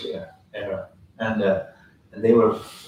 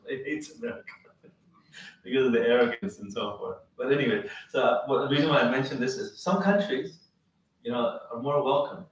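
ordinary talk

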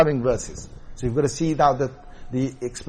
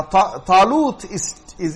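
ordinary talk, nothing marked

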